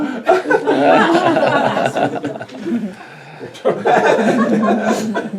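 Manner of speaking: long, loud, high laugh; real, helpless laughing fit; hearty laughter. Real, not acted. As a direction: burst out laughing at length. Laughing at own name drawn.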